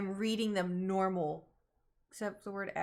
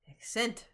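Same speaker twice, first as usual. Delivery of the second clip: "Accent" as ak-SENT, stress on the second syllable; stress shifted late. Shown as a demonstration. Testing another way after being corrected, "Accent" as ak-SENT. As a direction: lean on "cent" in "Accent", rising. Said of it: "more emphasis on the axe in the beginning".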